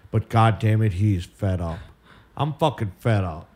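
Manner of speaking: low, grumbling, worn-out host; fed-up Fickle. Acted. Fickle tells listeners the show is done, low and bitter.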